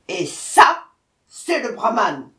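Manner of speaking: loud, punched-out pointing; furious tiger. A narrator's reading. Tiger showing the brahman to the jackal, furious. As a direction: bark each part loudly. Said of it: "le tigre furieux".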